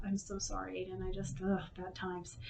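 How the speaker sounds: a hushed, rueful apology; quiet regret. Real, not acted. A mother quietly apologizing to her son, rueful.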